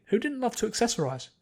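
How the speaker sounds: usual speaking voice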